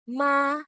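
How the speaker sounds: MA held high and perfectly level; high flat tone. Shown as a demonstration. Teacher modelling the first tone, high and flat. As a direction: sing out MA on one high, flat note. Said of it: "One more time".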